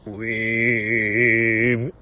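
long, low khoomei-style drone; badly faked Mongolian overtone singing, drawn out; mock throat singing. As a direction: hold an amateur throat-singing note. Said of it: "まあ、僕はできないですけど、".

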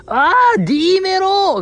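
high, drawn-out gleeful shout; imitated shout from the peanut gallery; loud, excited heckle. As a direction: call it out like an overexcited fan imitation. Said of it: "誰よりも大きい声で言ってた、 はしゃいでた".

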